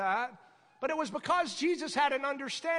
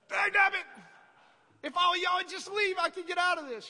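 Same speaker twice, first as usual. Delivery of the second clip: loud, higher-pitched mock rant; exasperated outburst; 6 semitones higher, 4 dB louder than usual. Preacher replaying his wish that everyone would leave, exasperated outburst. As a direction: vent it loudly, as if trapped. Acting out frustration with congregation.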